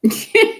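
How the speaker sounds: bright high burst of laughter; high amused laugh at a viewer's question. Real, not acted.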